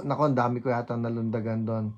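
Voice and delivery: ordinary talk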